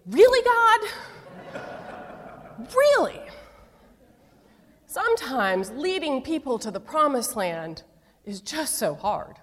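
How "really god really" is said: exasperated disbelief. Retold or imitated. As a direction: cry to heaven in comic disbelief. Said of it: "I'm thinking".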